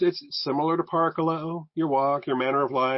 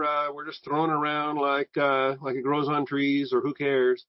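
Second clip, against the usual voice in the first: ordinary delivery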